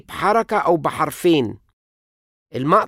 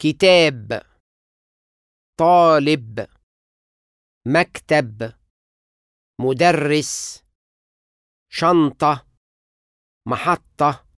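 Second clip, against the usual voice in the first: each word said alone with clear stress; stress demo words; slower than usual. Shown as a demonstration. Teacher demonstrating stress on example words. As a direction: demonstrate the stressed heavy syllable. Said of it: "النبر بيقع على الحركة بتاعت مجموعة تقيلة".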